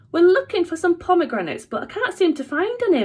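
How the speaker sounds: bright, high polite mum voice; sweet-talking a grumpy manager; smiling politely. A narrator's reading. Mum asking the rude manager for help, smiling sweetly. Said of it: "smiling even more".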